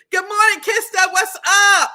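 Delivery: high, loud hello to a viewer; big loud welcome to a friend; excited greeting. Real, not acted. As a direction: call out a happy good morning across the room.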